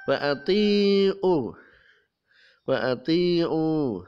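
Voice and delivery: Wa atiu, slow, long ee sound; Quran recitation. Shown as a demonstration. Teacher reciting Wa atiu for students. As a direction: chant Wa atiu slowly. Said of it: "Ada kasroh bertemu dengan ya sukun".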